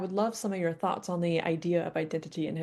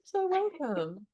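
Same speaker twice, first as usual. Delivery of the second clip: slow, high and tender; heartfelt welcome; 7 semitones higher, slower than usual. Real, not acted. Host answering the guest's thanks, slow and tender. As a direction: draw it out, touched.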